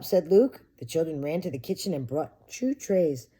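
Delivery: plain reading voice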